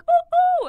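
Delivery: high squealing ooh; mock excited squeal. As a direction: squeal it like a fake thrilled fan.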